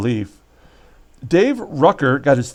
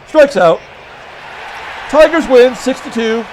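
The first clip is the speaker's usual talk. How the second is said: excited call; 7 semitones higher, 4 dB louder than usual. Real, not acted. Calling the final out.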